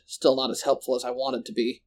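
ordinary delivery

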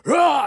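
short, high, loud charging yell; mock battle roar. Preacher mimicking his old rush at darkness, mock roar.

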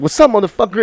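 high, loud challenge from the big brother; tough big brother. Retold or imitated. Big brother confronting the bully, high loud challenge. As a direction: call him out like the brother stepping in.

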